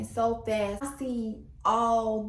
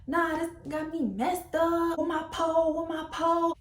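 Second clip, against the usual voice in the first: imitated mad guy; 7 semitones higher than usual. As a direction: act out his agitated, pitched-up fuming. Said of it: "He mad".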